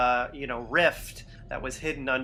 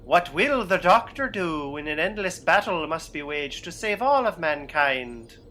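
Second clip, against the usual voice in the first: usual speaking voice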